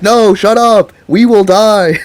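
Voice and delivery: high, loud, panicky yell; scared friend shouting him down; panicked shout. Retold or imitated. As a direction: cut him off in alarm. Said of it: "And Richie's like".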